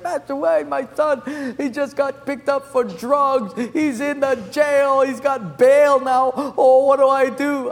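high, loud mock wail to the pastor; hammed-up tearful panic; imitated crying mother. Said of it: "She's crying".

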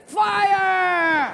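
a high, loud panicked yell of "Fire!"; high shout of alarm. Acted. Portuguese prisoner panicking at the firing squad, loud yell.

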